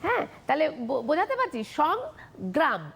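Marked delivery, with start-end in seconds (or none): 1.6-2.9 সংগ্রাম slowly, halting after সং; blocked syllables. Shown as a demonstration. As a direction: close off সং, then gram. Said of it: "গলাটা আটকে যাচ্ছে".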